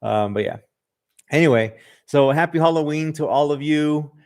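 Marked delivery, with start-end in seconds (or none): none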